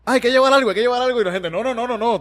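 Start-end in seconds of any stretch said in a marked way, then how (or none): 0.0-1.2 high, loud eager offer; excited young helper, high and loud; eager newbie. Retold or imitated. 1.5-2.2 mock crew brushoff. Crew refusing his help.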